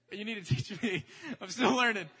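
laughing while joking; teasing the crowd, laughing; laughing aside. Real, not acted. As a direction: say it through laughter.

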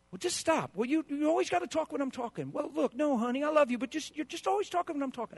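high, loud exasperated scolding; exasperated spouse. Retold or imitated. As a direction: nag your spouse, fed up. Acted spouse quarrel.

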